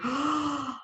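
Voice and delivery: high breathy oh after a gasp; be struck with surprise. Retold or imitated. Leader hearing about the dolphin, gasping in surprise.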